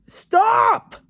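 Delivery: high, loud, drawn-out yell; mock outraged shout. Vlogger telling viewers to stop looking, mock outraged yell. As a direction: shriek it in mock embarrassment, stretching the word.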